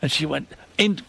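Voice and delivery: ordinary delivery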